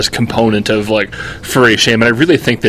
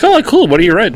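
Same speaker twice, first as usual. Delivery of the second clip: higher-pitched imitation of an interested listener; 7 semitones higher, faster than usual. Seatmates asking a writer about the work, interested. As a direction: lightly act out friendly curiosity, pitched up. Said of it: "thought that was interesting".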